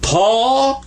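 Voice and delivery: "tall" as a long rising "taw". Shown as a demonstration. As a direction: stretch "tall" upward, leaving off the L. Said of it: "Don't say the L on it".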